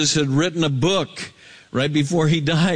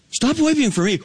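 high, insistent scriptural command; high-pitched, impassioned plea; urgent preaching rebuke; 13 semitones higher, faster than usual. Acted. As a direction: preach it as a pressing admonition, pitch way up. Same scripture repeated as forceful rebuke.

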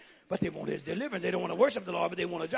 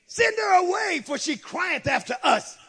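pleading disciples' demand; 9 semitones higher, 7 dB louder than usual. Acted. Disciples begging Jesus to send the woman away, insistent. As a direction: voice an indignant plea to be rid of her.

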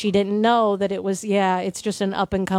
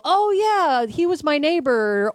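eager fan claim; 7 semitones higher than usual. Retold or imitated. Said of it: "somebody will go".

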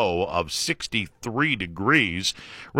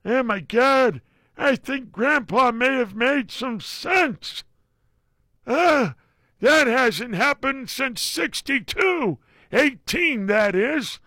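high mock voice; 13 semitones higher, slower than usual. High voice after grandpa bit.

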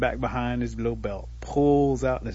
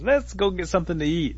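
higher-pitched, lively storyteller's Possum; eager suggestion; 7 semitones higher, 4 dB louder than usual. Acted. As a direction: propose it eagerly to a pal. Possum proposing a meal, voiced.

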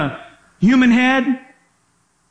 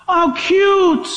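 mock cooing; 3 semitones higher, much slower than usual. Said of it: "just like you said".

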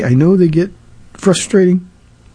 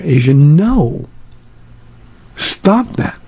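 firm scolding; much slower than usual. Retold or imitated. Grandpa scolding toddler at beach.

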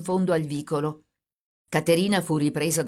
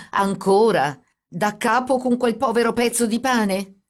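louder and higher, indignant; 6 semitones higher, 5 dB louder than usual. A narrator's reading. Brother repeats her fear, indignantly. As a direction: throw the word back, outraged.

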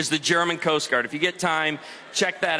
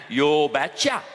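low Norwegian father's voice; imitated Norwegian drawl, low-pitched; thick Norwegian accent; 5 semitones lower, slower than usual. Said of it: "responded in his thick Norwegian accent".

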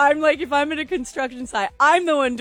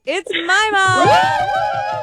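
long, drawn-out ring-announcer yell, much higher and louder; hyped announcer shout; 11 semitones higher, 6 dB louder, much slower than usual. Acted. Co-host announcing the caller's nickname on air, drawn-out hype shout. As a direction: belt the name like a fight intro. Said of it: "Love your passion there".

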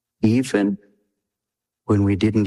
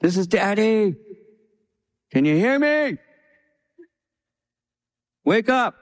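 high, slowed call into the belly; mock yell to baby; 11 semitones higher than usual. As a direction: call out to the unborn baby, playful. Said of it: "And I would yell this".

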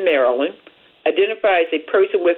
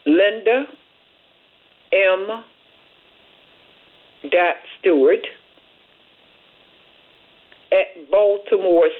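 email read piece by piece with long pauses; slow dictation. Shown as a demonstration. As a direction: dictate it so listeners can write it down. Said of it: "these are lowercase letters".

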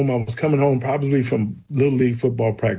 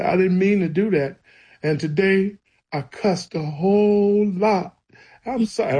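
put-on higher voice, pleading like a sorry child; retold crying confession; 6 semitones higher than usual. Child confessing the day's cussing to God, imitated tearful pleading. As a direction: act out a tearful apology, no real sobs. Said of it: "I'm crying and I'm telling him everything".

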